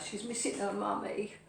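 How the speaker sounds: normal talking voice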